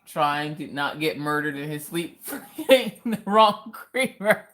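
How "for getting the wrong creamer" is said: words breaking up into laughter; real laughter through the words; laughing. Real, not acted. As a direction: crack up while finishing the joke. Laughing at her own joke.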